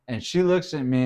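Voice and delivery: usual speaking voice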